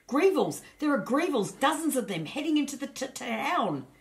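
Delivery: high, loud, out of breath; gasping out the warning; breathless panic. A narrator's reading. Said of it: "gasped Roger breathlessly".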